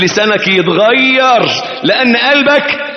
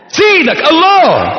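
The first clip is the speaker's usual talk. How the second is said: drawn-out raised shout; slow proclaiming shout; 10 semitones higher, slower than usual. Real, not acted. Preacher to the congregation, drawn-out shout.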